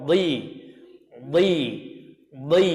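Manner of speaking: dhi said with a thick emphatic dh; dha demo. Shown as a demonstration. Teacher models the dha syllable for students. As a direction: keep dhi heavy with the tongue up. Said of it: "Huruf dha".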